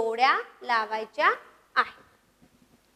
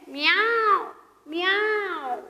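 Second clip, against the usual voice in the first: peacock cry; 5 semitones higher, much slower than usual. Shown as a demonstration. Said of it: "सगळ्यांनी मोर या पक्षाचे आवाज काढायचे आहे".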